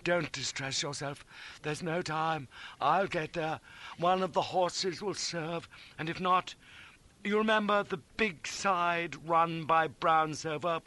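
high, slow fervent tenderness; slow, emotional promise with solemn pauses; noble farewell. A narrator's reading. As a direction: vow earnestly, swelling with heroic feeling. Noble farewell to the injured friend.

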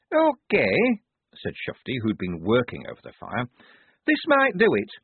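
put-on high young woman's voice; lightly voiced eager cook; Shufti voice. A narrator's reading.

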